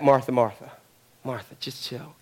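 low, hushed reassurance; telling to chill. Acted. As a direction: wind down softly to calm her. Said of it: "It means chill out".

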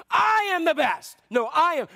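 loud high squabble, each shouting over the other; disciples yelling. Retold or imitated. Disciples yelling at each other over who is best. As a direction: shout it like grown men bickering. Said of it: "They were yelling at each other".

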